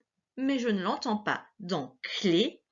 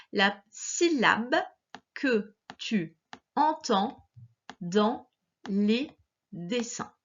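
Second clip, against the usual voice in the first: "la syllabe que tu entends dans les dessins" slowly, pausing between words; word-by-word dictation; slower than usual. Shown as a demonstration.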